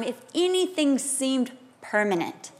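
normal talking voice

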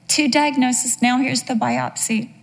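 ordinary talk

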